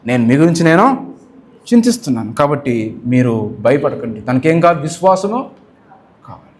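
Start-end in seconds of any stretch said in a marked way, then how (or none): 0.1-4.2 God reassuring. Retold or imitated. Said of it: "కానీ దేవుడు అన్నాడు".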